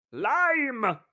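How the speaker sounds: high, loud bark of a word; drunk bellow. Acted. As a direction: bellow without looking up. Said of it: "the master bellowed".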